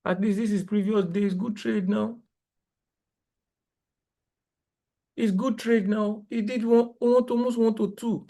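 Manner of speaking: high, marvelling at a good trade; excited praise. Real, not acted. Trader to his viewers about yesterday, excited and high. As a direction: sell how big the move was.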